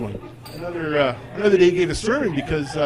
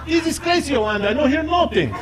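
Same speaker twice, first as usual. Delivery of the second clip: put-on much higher exasperated Italian accent; exasperated Italian dad; 12 semitones higher than usual. Dad complaining to Yolanda, high exasperated Italian accent. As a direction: throw up your hands at the phone. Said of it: "voicemail on my phone that says".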